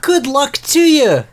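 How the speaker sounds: high, loud, slow taunt; cocky taunting fighter. Acted. Player character taunts the giant brother, high and slow. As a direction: goad the big man, teasing him to try.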